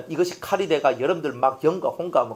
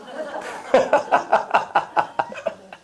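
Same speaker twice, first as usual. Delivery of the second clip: long chuckling laugh; drawn-out staccato laughter; 7 semitones higher than usual. Real, not acted. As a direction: laugh at your own confession. Laughs at own confession.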